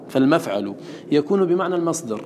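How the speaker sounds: ordinary delivery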